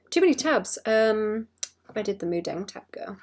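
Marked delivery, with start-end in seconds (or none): 0.1-0.8 exasperated. Real, not acted. Repeats higher, exasperated.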